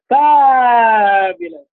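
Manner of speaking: mock host hype on fabulous; imitating the host's gush. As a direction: stretch fabulous like a gushing host. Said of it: "carry it off as well as you do".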